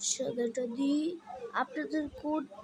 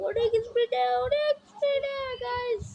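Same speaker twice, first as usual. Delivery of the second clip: much higher, louder, building to a peak; climbing excitement; 12 semitones higher, 5 dB louder than usual. Shown as a demonstration. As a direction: ramp the pitch up as you sprint. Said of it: "অনেকক্ষণ দৌড়াচ্ছি আমি".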